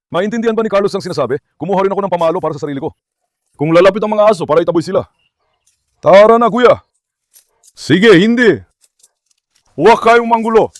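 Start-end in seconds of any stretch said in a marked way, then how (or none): 6.0-10.7 loud, firm call to the dogs; calling out firmly. Real, not acted. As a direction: raise the voice to keep the pack in line. Dogs being herded, owner calls out. (malakas at matigas na pagtawag)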